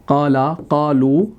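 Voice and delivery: काला, कालू spelled out slowly, each syllable drawn out for students; slow drilled forms. Shown as a demonstration. A teacher slowly sounding out काला, कालू for students. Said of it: "समझने के लिए".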